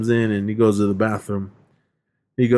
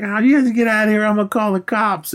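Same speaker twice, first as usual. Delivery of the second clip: high, lightly acted outrage; mock indignant threat; 9 semitones higher than usual. Woman ordering them out of her house, mock indignant. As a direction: pitch it up like someone throwing people out. Retold threat to call police.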